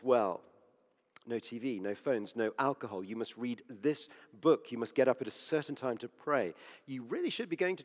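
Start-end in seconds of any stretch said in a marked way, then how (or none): none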